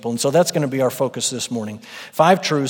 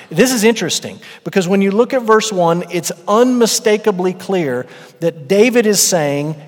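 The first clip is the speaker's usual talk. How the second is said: louder, higher, insistent preaching; preaching at full force; 4 semitones higher, 6 dB louder than usual. Acted. Pastor proclaiming to the church that Yahweh is his shepherd, loud and emphatic. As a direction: drive it home to the congregation.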